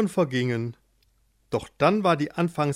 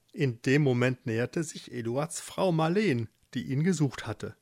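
ordinary delivery, nothing marked

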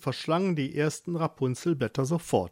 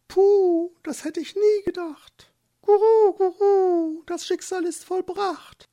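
much higher, louder twittering dove voice; high, fluttery pigeon chatter; cooing doves; 6 dB louder than usual. Acted. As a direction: coo the warning like an excited pigeon. The doves comment.

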